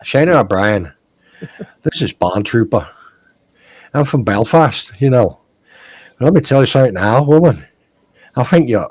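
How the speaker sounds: loud hammed-up Irish accent, ending low. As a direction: play a loud Belfast lad chatting up a woman. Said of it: "in an Irish accent here".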